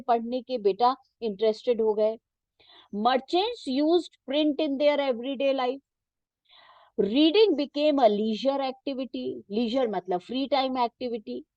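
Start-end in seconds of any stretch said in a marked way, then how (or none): 2.9-9.4 reading a textbook sentence very slowly for students to follow; teacher reading the book aloud, slow and measured. Shown as a demonstration. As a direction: dictate it slowly, word by word.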